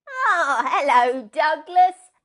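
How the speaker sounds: high, loud bleat of a sheep. Acted. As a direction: baa the greeting like a sheep. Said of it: "barred the sheep".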